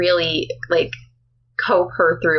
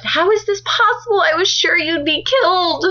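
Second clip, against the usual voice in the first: acted distraught disbelief, pitched much higher; upset; 10 semitones higher than usual. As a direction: play a frantic, high-pitched upset friend. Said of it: "And she was so upset".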